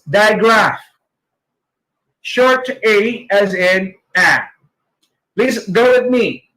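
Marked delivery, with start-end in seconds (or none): none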